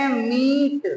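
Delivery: मीट slowly, with a long ee; मीट said slowly, ee held; stretched ee. Shown as a demonstration. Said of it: "खींच कर".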